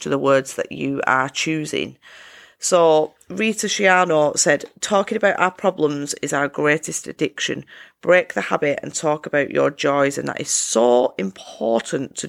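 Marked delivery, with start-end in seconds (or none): none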